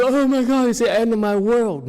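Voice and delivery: loud, high, overblown wail of panic; mock despair. Teacher imitates someone just yelled at, mock despair. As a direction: mimic someone melodramatically falling apart. Mocking a yelled-at person's overreaction.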